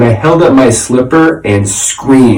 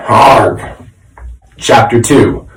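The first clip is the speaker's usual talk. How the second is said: ordinary delivery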